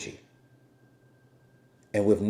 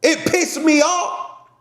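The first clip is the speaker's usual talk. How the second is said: angry shout; 7 dB louder, slower than usual. Real, not acted. Angry about police stop.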